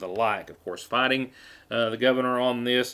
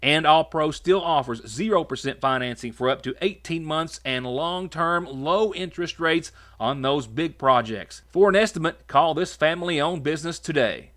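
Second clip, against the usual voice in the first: ad read; 4 semitones higher than usual. Acted. Energetic live ad read.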